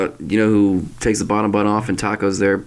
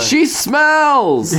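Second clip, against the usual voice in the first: high mocking sitcom voice; 3 dB louder than usual. Voicing sitcom character.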